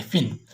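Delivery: फिन with a short i; vowel demo. Shown as a demonstration. Teacher modelling the vowel in pin. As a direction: say फिन crisply. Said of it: "वो ये sound देता है".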